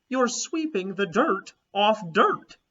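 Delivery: high, loud and slow; amazed child. A narrator's reading. Child to the pilgrim lady, loud amazed disbelief. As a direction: spell out the obvious in disbelief.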